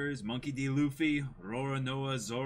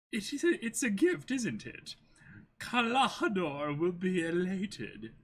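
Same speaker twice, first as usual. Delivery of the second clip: goat butler voice; 5 semitones higher than usual. Acted. Butler reporting the errand to Kaya, high and formal.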